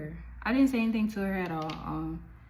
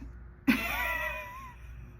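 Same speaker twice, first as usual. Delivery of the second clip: high laugh; 4 dB quieter than usual. Real, not acted. Laugh after retold question.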